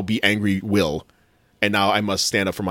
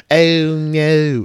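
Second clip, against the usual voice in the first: put-on high and louder "Oh, no" in Valley girl style; a sing-song Valley girl "Oh, no"; Valley girl accent; 6 semitones higher, 5 dB louder than usual. Shown as a demonstration. Host imitating the cohost's accent, Valley girl. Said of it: "that's very Valley".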